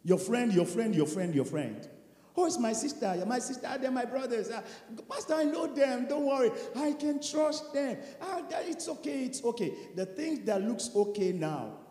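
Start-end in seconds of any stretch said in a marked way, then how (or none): 2.4-9.7 quiet, casual brush-off; soft dismissive excuse. Acted. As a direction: wave the pastor away gently. Believer brushing off pastor's warning.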